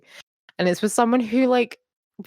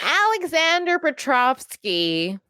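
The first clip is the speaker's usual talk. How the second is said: slow, high, overdone American "Alexander Petrovsky"; exaggerated American accent; 8 semitones higher, slower than usual. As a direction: ham up "Alexander Petrovsky" with broad American vowels. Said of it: "She's being extra American".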